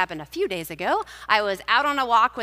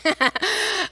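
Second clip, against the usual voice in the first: sheepish laugh; 11 semitones higher, 4 dB louder than usual. Real, not acted. Laughing at her own admission.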